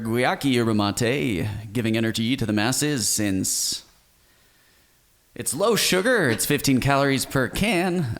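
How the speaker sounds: put-on ad announcer with big pitch swoops. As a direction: play a showy announcer, drawing out the product names. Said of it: "I'll do, like, an announcer voice for it".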